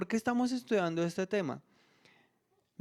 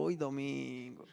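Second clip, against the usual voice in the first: slow, soft and sleepy, just waking up; groggy sigh on waking; 4 semitones lower, 9 dB quieter, slower than usual. Retold or imitated. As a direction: sigh it out as if barely opening your eyes. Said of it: "cuando abro los ojos y digo". (con desgano soñoliento, al despertar un domingo)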